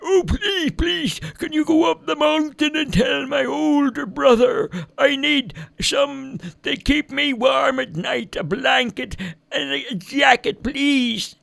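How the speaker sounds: put-on very high, slow, quavering old man's plea; older man pleading. A narrator's reading. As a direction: beg like a shivering ancient man. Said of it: "an even older, older man".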